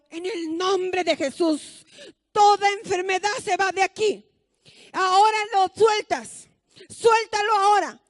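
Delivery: fervent shouted prayer. Retold or imitated. Mother rebuking the sickness over the patient, shouted. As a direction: rebuke the illness with full force. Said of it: "empieza a orar".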